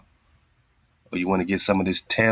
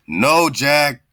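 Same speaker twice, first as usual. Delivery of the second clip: emphatic no; 7 semitones higher, 6 dB louder than usual. Acted. Emphatic correction of puppet.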